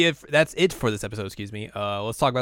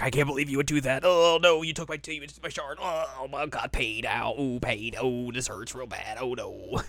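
hammed-up whimpering complaint, trailing softer; mock pained lament; 3 semitones higher, 4 dB quieter than usual. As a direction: moan through it in mock agony. Mock lament of Sango in pain.

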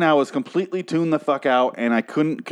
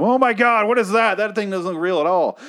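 high-pitched retold shock; startled outburst, pitched way up; jolted awake; 8 semitones higher than usual. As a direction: exclaim it high, imitating appalled disbelief. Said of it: "it actually woke me up".

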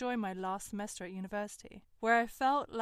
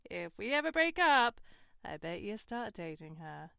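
teasing; 6 dB louder than usual. Retold or imitated. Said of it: "in jest".

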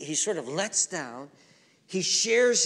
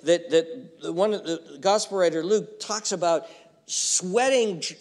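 ordinary talk, nothing marked

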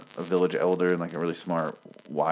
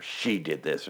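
softer, mock-sinister delivery; imitated evil whisper; 4 semitones lower, 8 dB quieter, slower than usual. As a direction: say it softly, like a melodramatic villain. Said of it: "in an evil whisper".

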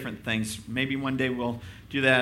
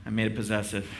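ordinary talk

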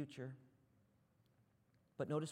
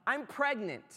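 higher, louder blurted announcement; announcing fiancee; 8 semitones higher, 7 dB louder than usual. Retold or imitated. Fiancee tells Joseph the news, blurted. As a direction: drop the news in one go. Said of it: "And she's like".

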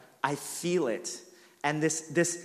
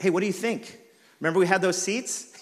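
higher, strained fake-casual question; passive-aggressive hint to the wife about the old seats; forced calm hint; 5 semitones higher, 5 dB louder than usual. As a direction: needle her while keeping it together. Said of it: "I'm trying to keep it together".

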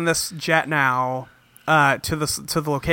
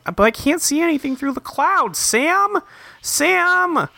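whiny Alex; 11 semitones higher than usual. Retold or imitated. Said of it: "she's like".

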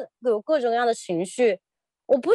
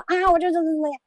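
higher-pitched imitated outburst; mock venting; 7 semitones higher, faster than usual. Wife imagining venting at her husband, mock outburst. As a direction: briefly act out letting it all out. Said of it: "把那个情绪发泄出来".

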